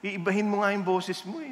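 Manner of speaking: ordinary talk, nothing marked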